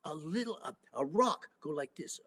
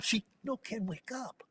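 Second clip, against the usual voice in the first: usual speaking voice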